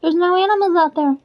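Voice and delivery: put-on a puzzled small boy; boy telling his mom, high; kid voice. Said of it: "And he's like".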